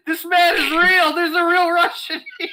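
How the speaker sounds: amazed. Retold or imitated. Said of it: "And I'm like".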